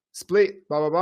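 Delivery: ordinary talk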